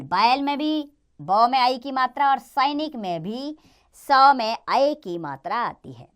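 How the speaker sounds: ordinary talk, nothing marked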